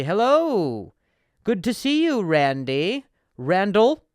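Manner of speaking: high, loud, mock-posh hello; overly formal greeting. Greeting the client with hammed-up formality. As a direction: greet him with exaggerated pomp.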